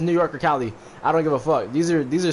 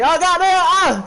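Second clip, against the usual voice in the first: loud, high-pitched cry of pain; panicked high wail, acted out; acted scream; 7 dB louder than usual. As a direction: yelp out in mock agony, much higher and louder. Acted lady running out hurt, screaming.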